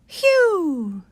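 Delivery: high falling whistled "whew"; mystery bird call. Acted. Narrator imitating the strange bird call.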